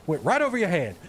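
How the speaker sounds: loud, high Southern bluster; hammed-up cartoon Southerner. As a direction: boom it out like a pompous Southerner. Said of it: "used to quote that all the time".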